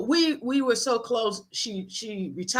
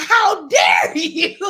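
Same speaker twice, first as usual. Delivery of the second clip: high, loud, slow mock outrage; hammed-up shock; 7 semitones higher, 7 dB louder, much slower than usual. Grandson reacting to grandmother's scolding, hammed-up outrage.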